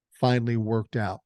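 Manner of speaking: usual speaking voice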